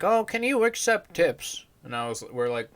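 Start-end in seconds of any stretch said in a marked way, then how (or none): 0.0-1.6 put-on high, sweet little-old-lady voice. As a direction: imitate a kind grandma asking politely. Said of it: "she's like".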